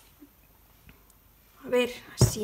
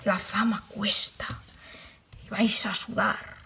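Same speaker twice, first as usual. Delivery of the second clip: whispered Fama quote; 5 semitones lower than usual. Retold or imitated. Quotes the Fama TV line to her followers in a slow whisper. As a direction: breathe the line "La fama cuesta" with no voice, slowly. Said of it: "lo que decían en Fama".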